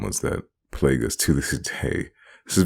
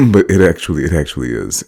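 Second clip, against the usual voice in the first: laughing; 3 semitones higher, 7 dB louder than usual. Real, not acted. Laughing through the line.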